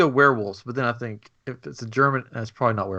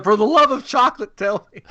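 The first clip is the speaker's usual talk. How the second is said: higher, louder hammed-up begging; mock desperate plea; 10 semitones higher, 6 dB louder than usual. Visitor begging the chocolatier for his recipe, hammed-up plea. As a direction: beg for the recipe, over the top.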